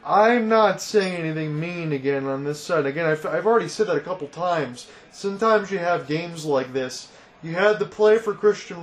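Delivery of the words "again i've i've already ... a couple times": normal talking voice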